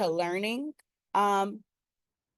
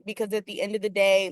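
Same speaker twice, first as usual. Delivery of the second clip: usual speaking voice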